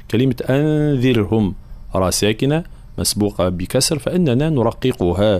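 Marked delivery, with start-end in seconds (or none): none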